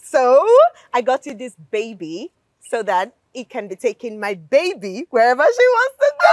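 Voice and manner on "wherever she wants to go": high, loud and fast; excited brag. Real, not acted. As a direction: rush it out in delight. Bragging about boyfriend's gift.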